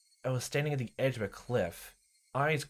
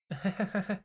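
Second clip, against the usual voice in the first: put-on high eerie girlish giggle; a small unsettling laugh; creepy giggle; 10 semitones higher than usual. Rena giggling at Keiichi, high and eerie.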